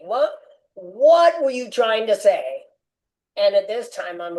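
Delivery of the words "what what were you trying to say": pressing the question, slow and louder; frustrated demand. Retold or imitated. Man pleading with God in the kitchen, frustrated and pressing. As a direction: demand an answer, voice rising with frustration. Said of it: "I'm frustrated".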